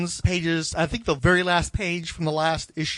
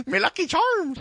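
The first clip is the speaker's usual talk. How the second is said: mock Irish; 12 semitones higher than usual. Joking about Irish cereal.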